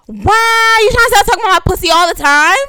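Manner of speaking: loud, high-pitched outburst; indignant shout. Real, not acted. Woman objects to co-host's teasing, indignant shout.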